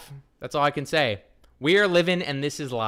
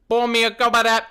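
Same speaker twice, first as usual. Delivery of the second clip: excited; 8 semitones higher, 7 dB louder, faster than usual. Acted. Excited request for music.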